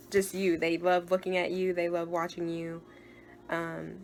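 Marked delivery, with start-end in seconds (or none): none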